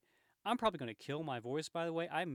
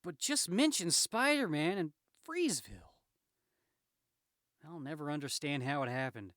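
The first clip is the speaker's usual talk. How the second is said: animated, higher, lightly voiced protest; aggrieved Spider-Man; 6 semitones higher, 7 dB louder than usual. Spider-Man blaming Jameson for his reputation, indignant. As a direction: plead your case with comic exasperation.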